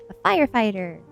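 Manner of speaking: put-on loud narrated child's shout; shouted answer. A narrator's reading. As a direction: call out the answer loudly in character. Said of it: "he shouted".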